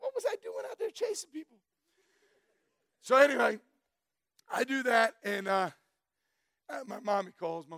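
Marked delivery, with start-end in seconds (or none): 0.0-1.6 high, fast mock disbelief; new trucker mocking his old cop days, high fast disbelief; incredulous self-quote. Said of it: "I'm like".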